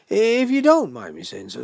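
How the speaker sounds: booming mock-polite lead-in; insistent host. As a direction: press the point in a big host's voice. Host presses the point louder.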